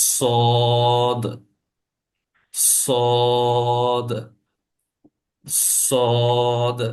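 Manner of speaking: সদ with a firm, thick s and long held vowel; slow model pronunciation of সদ, thick and sustained; heavy Sad. Shown as a demonstration. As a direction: draw out সদ full and heavy. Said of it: "এই সদের উচ্চারণটা হবে একটু শক্ত এবং উঁচু".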